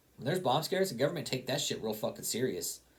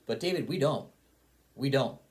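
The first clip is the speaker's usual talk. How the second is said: higher, louder insistence; firm retort; 5 semitones higher, 5 dB louder than usual. Real, not acted. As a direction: throw it back at David hard. Rebutting David directly.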